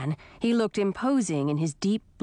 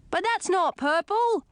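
high-pitched objection from a squire; piping squire; 11 semitones higher than usual. A narrator's reading. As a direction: pipe up in a much higher voice. Squire pipes up in story.

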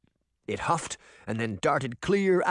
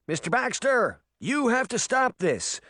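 higher urgent shout; 10 semitones higher than usual. A narrator's reading. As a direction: shout at him to stop. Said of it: "Uncle Jake shouted".

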